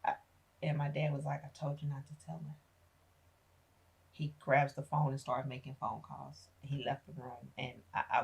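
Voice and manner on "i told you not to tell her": muttered blame. Retold or imitated. Dad blames mom.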